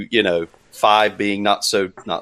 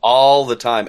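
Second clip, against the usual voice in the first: ordinary talk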